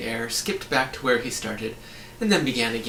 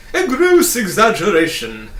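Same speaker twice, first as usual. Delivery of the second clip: loud, high indignant bug; furious protest; 9 semitones higher, 12 dB louder than usual. A narrator's reading. Humbug protests the boy's insult, loud and furious. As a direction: sputter it out in outrage. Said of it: "protested the furious bug".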